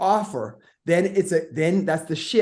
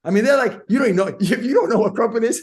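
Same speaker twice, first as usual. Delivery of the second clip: ordinary talk, nothing marked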